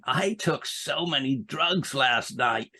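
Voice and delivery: slow, drawn-out American comic brag; lightly put-on American swagger; mock American stand-up. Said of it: "the American stand-ups had all been saying".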